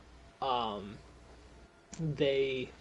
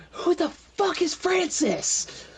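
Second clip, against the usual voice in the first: high, slow, drawn-out acted shout; yelling; 8 dB louder, slower than usual. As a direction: holler it down a hallway.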